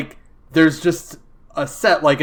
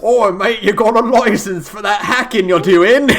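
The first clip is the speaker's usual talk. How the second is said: jokey British bloke, higher and louder; mock British accent; 5 semitones higher, 5 dB louder than usual. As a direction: lampoon a badly voiced British hacker. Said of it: "the accents in that gameplay were really bad".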